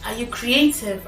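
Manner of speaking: ordinary delivery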